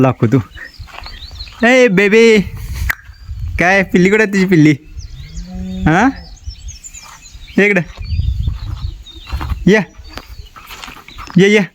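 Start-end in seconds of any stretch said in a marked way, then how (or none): 1.7-11.6 higher, affectionate pet talk; coaxing the dog. Real, not acted. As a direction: sweet-talk the mother dog to show her puppies.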